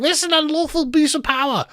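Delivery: very high-pitched put-on shriek; mock screaming. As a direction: imitate a hysterical protester, voice shot right up. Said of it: "people like you screaming".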